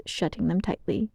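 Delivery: plain reading voice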